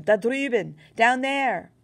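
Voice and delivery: high, loud yell. A narrator's reading. German soldier yelling where they are, high and loud. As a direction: shout the direction out. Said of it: "yelled one of them".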